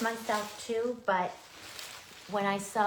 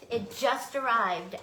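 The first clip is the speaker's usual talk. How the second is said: usual speaking voice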